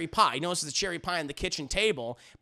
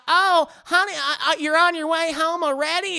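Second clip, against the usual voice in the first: put-on high, loud Southern-accented wife; Bridget; 10 semitones higher, 7 dB louder than usual. Wife greets husband coming home early, high Southern accent. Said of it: "She has a very deep Southern accent".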